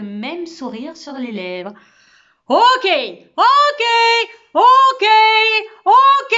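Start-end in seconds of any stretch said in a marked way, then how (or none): 2.5-6.4 very high, full-volume repeated shouts; siren-like bellow. A narrator's reading. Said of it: "gueuler si fort". (hurlement de sirène)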